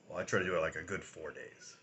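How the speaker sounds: usual speaking voice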